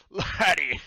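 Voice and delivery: loud, high outburst; startled captain's bellow; spluttering captain. Acted. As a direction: cry out after choking on a drink. Captain splutters after bad drink.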